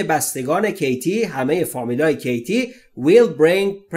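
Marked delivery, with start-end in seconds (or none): none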